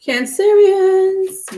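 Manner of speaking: high, slow sing-song call to the viewers; drawn-out call. Real, not acted. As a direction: stretch the name out high and slow like greeting a crowd. Calling out to her sign viewers.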